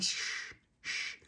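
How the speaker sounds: soft unvoiced shushing; hushing. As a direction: hush him gently. Calming stuck crying Tony.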